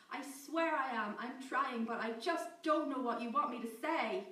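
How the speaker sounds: loud, desperate wail, slowed. Acted. Captive Amy wailing her plea to Clickshoe. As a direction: plead like a distressed child. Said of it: "It was almost a wail".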